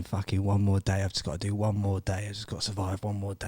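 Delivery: low, fading mutter to himself; weary self-talk. Retold or imitated. Man willing himself through day six, muttering wearily.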